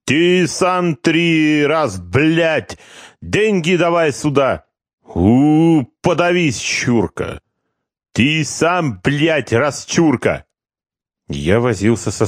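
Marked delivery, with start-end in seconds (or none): 0.0-4.7 put-on slow, high, heavily Georgian-accented demand. 5.0-7.4 high spiteful jeer that drops to a sneer; contemptuous insult. Acted. Corporal hurls money, insults. 8.1-10.5 put-on high Georgian-accented retort.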